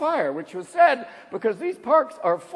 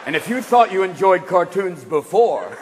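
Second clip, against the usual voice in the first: warning-label announcer; 7 semitones lower than usual. Retold or imitated. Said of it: "It's gonna have to say".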